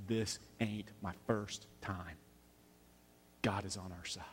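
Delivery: understated tough-guy resolve, almost under the breath; quiet toughness. Retold or imitated. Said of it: "There is this moment of toughness".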